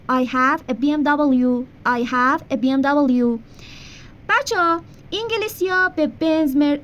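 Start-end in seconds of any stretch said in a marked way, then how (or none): 0.1-3.4 slow teacher demo of the sentence; slow model sentence. Shown as a demonstration. Teacher modelling English for Persian students, slow. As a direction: say it slowly for repetition. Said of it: "من یه بی ام و دارم".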